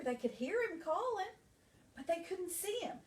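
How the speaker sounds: ordinary delivery, nothing marked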